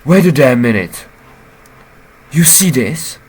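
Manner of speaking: high, loud eager rambling; excited shouting. Real, not acted. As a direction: blurt it out, thrilled by the clue. Player excitedly points out clue.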